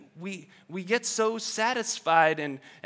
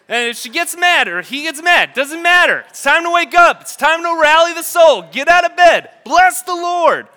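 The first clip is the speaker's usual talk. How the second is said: rousing preaching; 9 semitones higher, 14 dB louder than usual. Real, not acted. Preacher rallying congregation.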